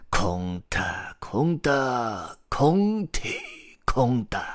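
slow, drawn-out storyteller's spell; magic incantation. A narrator's reading. Grandpa Storyteller casts his spell over the chicks, incanting slowly. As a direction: chant it like a magician casting a spell. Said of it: "开始念起了他的魔法咒语".

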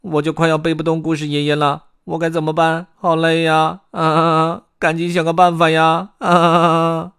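high, loud whining under a heavy load; 贝利奥叫苦. A narrator's reading. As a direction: moan with strained groans between complaints. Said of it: "贝利奥嘟囔着说". (高声吃力地抱怨、哼哼叫苦)

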